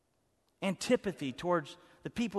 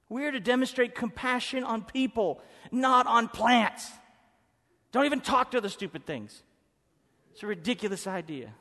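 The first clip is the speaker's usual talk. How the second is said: normal talking voice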